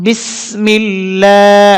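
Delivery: বিসমিল্লাহ with লা stretched one alif; long la. Shown as a demonstration. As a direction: hold the লা for one beat. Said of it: "এক আলিফ টানতে হবে". (লা এক আলিফ টেনে)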